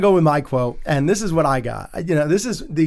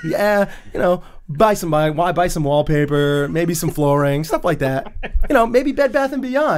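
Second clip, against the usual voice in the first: much higher, laughing, cheerful voice; giddy Frank; 5 semitones higher than usual. Retold or imitated. Retelling Frank's weekend plans to friends, high and giggling. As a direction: gush about boring errands like a treat. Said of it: "He goes".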